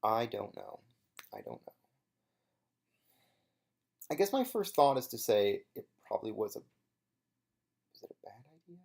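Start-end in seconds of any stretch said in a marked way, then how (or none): 1.3-1.7 "I don't know" dropped far softer and lower, almost swallowed; soft low aside; under breath. Real, not acted. 6.6-8.8 slow breathy whisper to yourself; self-questioning whisper. Real, not acted. Musing to self.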